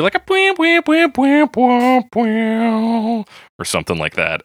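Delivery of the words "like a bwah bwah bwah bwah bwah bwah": big high sung "bwah" stabs, fading down; vocal imitation of a guitar finish. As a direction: belt out the guitar riff with your voice. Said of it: "official country finish where you do".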